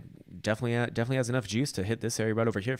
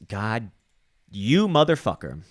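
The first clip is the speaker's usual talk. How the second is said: loud, slow, exasperated curse at the chart; angry curse; 3 semitones higher, 9 dB louder, slower than usual. Real, not acted. Trader losing patience with the chart, a loud, slow curse. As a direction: let it out, loud and drawn out.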